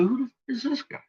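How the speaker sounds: quoting himself in his own video, stunned; incredulous retold question. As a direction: fire it off, baffled and fed up. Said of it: "I said".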